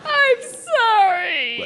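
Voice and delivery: long, drawn-out tearful wail; wailing with sympathy; sudden sobbing. Acted. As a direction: burst into tears on the apology. Bursts into tears at sad story.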